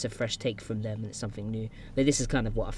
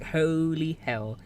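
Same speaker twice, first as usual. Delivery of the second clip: drawn-out, higher-pitched "holy hell"; retold amazement; 5 semitones higher, much slower than usual. Viewer recalling first hearing the singer's voice, amazed. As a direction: stretch it out in mock astonishment.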